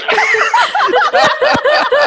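big burst of laughter, high and loud; real, helpless high laughter. Real, not acted. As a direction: crack up loudly at yourself. Laughing at own embarrassing story.

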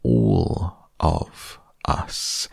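drawn-out words, each set apart; very slow, careful. A narrator's reading. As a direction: stretch every word slowly and deliberately. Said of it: "very slowly and carefully".

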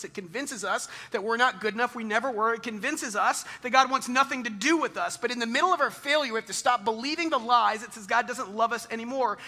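rising preacher's cry; preaching. Acted. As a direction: drive the point home to the congregation. Preaching builds to full force.

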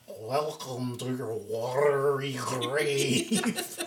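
very slow, drawn-out mock-eerie welcome breaking into laughter; comically elongated spooky greeting; Titanic dead impersonation. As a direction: stretch every word like a goofy ghost. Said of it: "pretending to be the people in the Titanic".

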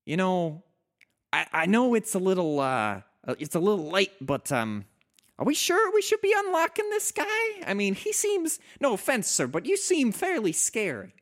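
nervous, high, hesitant voice; timid high voice. Acted. DM voices timid Spike NPC.

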